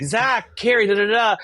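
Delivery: high, sing-out hellos, retold; gushing greeting. Arriving guest greeting friends in the house, gushing. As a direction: call out friends' names in a mock party greeting.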